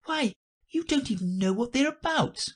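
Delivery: put-on high girlish objection; very high, indignant girl voice; contradicting Alice. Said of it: "said Alice".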